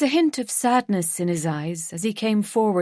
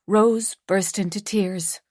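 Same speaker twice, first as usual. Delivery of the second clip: plain reading voice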